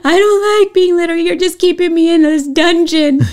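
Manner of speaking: put-on high, loud, fast whining little girl; whiny child. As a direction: rush the complaint like a sulky kid. Mother voices daughter complaining.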